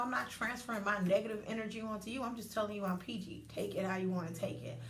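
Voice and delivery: ordinary talk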